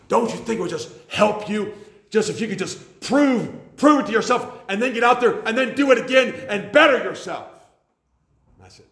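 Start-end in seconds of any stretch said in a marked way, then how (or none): 0.1-7.5 much higher and louder, a pushy eager voice; urging young preacher. Retold or imitated. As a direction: mimic the cocky young preacher pressing hard. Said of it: "he's just urging me on and on".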